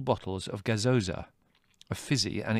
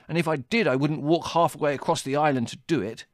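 indignant, higher and louder; icy; 6 semitones higher, 5 dB louder than usual. A narrator's reading. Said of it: "he said icily".

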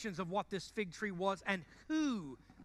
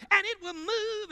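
high, loud, ringing preacher cry; Pentecostal preaching voice; 11 semitones higher, 7 dB louder than usual. Shown as a demonstration. Pastor shows the church his Pentecostal voice, loud and high. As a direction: belt it like a revival preacher. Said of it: "that's my real Pentecostal voice".

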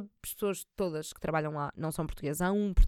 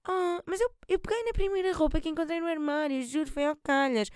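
high, breezy humblebrag; faux-modest voice; 8 semitones higher than usual. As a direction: coyly fake innocence.

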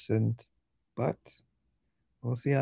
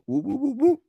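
whoop whoop sound effect; 11 semitones higher, 4 dB louder than usual. Retold or imitated. Mimicking a quick exit.

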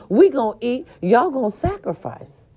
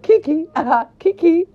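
laughing; 7 semitones higher than usual. Real, not acted. Laughing at Jada news.

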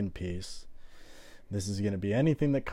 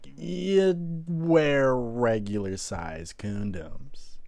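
slow emphatic; 3 semitones higher, much slower than usual. Real, not acted.

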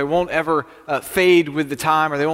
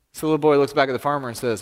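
ordinary delivery, nothing marked

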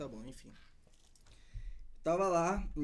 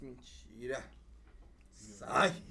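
normal talking voice

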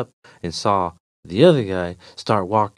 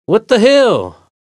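loud, high, drawn-out exasperation; annoyed; 13 semitones higher, 8 dB louder, slower than usual. Acted. Walker answers the repeating stranger, annoyed. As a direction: burst out in annoyance. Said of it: "annoyed by his voice and attitude".

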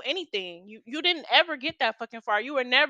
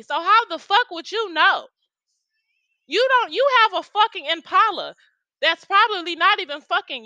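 loud, higher furious tirade at him; angry outburst; 6 semitones higher, 6 dB louder than usual. Real, not acted. Ranting at the lying ex about his Impala, loud and furious. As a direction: let him have it, raising your voice high. Yelling at the man who lied.

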